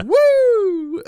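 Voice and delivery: very high, loud "woo"; high-pitched mock wrestler's cry; yelled whoop. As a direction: yell a long showy whoop. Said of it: "slaps people on the chest and yells".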